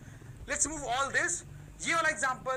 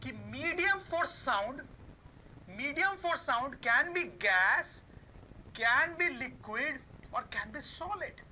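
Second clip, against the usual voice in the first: slow dictation; much slower than usual. Shown as a demonstration. Dictating notes to students.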